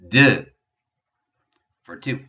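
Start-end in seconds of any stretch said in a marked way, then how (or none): 0.1-0.5 "De" loud, jaw pushed out, French EU vowel. Shown as a demonstration. Teacher models the number two, saying "De" clearly. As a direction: teach "De" with lips rounded, jaw forward. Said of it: "E U in French is a vowel sound".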